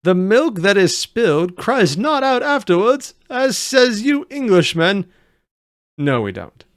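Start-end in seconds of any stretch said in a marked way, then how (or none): 0.0-5.0 high, slow, bad Dutch-German accent; fake Dutch-German accent. Reactor voices the goofy foreign doctor, fake Dutch-German accent. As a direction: do a badly faked Dutch accent, drawn out. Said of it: "I can't do a Dutch accent".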